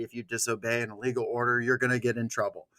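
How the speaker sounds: ordinary delivery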